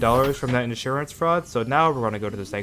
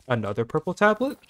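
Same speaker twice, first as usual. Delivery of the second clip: ordinary delivery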